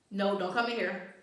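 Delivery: loud, firm order; real warning. Real, not acted. Owner to puppy trying to come in, real loud warning. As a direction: call out loudly to keep the dog away. Stopping the dog coming in.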